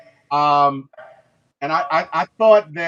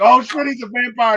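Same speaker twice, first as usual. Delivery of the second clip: higher, louder sudden alarm; alarmed outburst; 4 semitones higher, 6 dB louder than usual. Retold or imitated. Everybody panics as Delta Slim gags on garlic, alarmed outburst. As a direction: blurt it out like you just caught the monster. Group reacts to garlic test.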